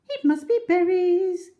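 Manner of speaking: very high, lilting bird call; roly-poly bird singing hello; sing-song bird. Acted. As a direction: chirp a cheerful greeting. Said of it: "sang the roly-poly bird".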